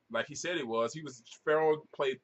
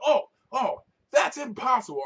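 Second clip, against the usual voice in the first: much higher, louder mock shock; mock disbelief; 12 semitones higher, 8 dB louder than usual. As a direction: play her scoffing at the claim. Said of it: "She was like".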